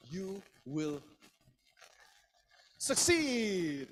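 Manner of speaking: "You... will..." held back, then "succeed" bursting out loud and high; rousing punch on "succeed"; pushed emphasis. Shown as a demonstration. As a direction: build through pauses to a shouted, stretched "succeed". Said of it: "The faster you push, the more important it is".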